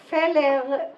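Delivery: Feller said as "feller", Hebrew style; Israeli mispronunciation. Shown as a demonstration. Imitating Israelis mispronouncing her name. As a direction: say Feller like an Israeli clerk reading it. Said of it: "the Israelis call me".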